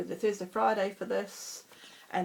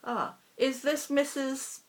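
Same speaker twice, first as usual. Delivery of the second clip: put-on heavy Indian accent; 7 semitones higher, slower than usual. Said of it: "his very heavy Indian accent".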